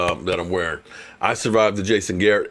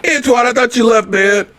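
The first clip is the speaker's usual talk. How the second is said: loud, higher playful ribbing; loud teasing; 9 semitones higher, 8 dB louder than usual. Real, not acted. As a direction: tease him loudly. Teasing younger friend loudly.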